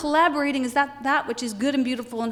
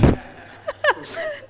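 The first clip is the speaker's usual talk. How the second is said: high burst of laughter; real laugh; 13 semitones higher than usual. Real, not acted. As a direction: crack up at your own confession. Laughs at her own confession.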